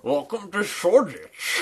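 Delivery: mock London guide greeting visitors in a British accent. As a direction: welcome them like a proud East Ender. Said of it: "doing a really good British accent".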